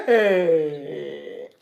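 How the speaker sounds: low rueful laugh with a groan. Real, not acted. Coach laughs at the caller's story, rueful disbelief.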